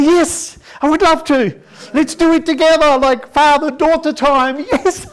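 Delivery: high, loud overeager agreement; eager dad. Retold or imitated. Father gushing to daughter about cleaning together. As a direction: gush like a scheming father. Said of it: "I say".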